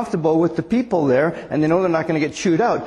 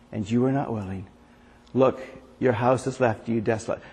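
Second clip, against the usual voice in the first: a soft, lowered reading of the lament; preacher reading Jesus' lament over Jerusalem, softer and lower; scripture reading; 5 semitones lower, 3 dB quieter than usual. Acted. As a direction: read it gently, as a grieving plea.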